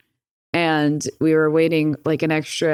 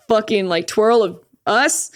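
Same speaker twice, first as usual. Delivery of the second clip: ordinary talk, nothing marked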